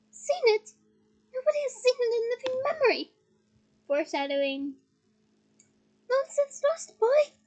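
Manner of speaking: high, scornful ghostly lady; haughty ghost. Acted. Ghost lady scolds Harry, high and scornful. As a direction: lift the pitch and scoff.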